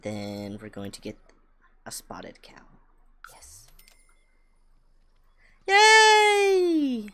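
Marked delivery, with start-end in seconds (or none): 5.7-7.1 much higher, louder squeal of delight; squealed yay. Real, not acted. Cheering purchase.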